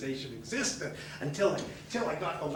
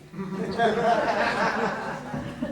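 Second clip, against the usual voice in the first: hearty laugh; 5 semitones higher, 4 dB louder than usual. Real, not acted. Laughing at own joke.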